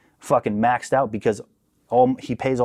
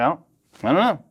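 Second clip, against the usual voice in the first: higher, sheepish, unsure; sheepish younger self; 7 semitones higher than usual. Retold or imitated. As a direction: shrug it off, a bit whiny. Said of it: "I was like".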